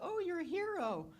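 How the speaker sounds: high, gushing voice of someone praising a hero; mock praise. Chief mimicking praise firefighters get, high mock gush. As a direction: gush it like fawning praise the civilians never get. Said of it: "kind of business".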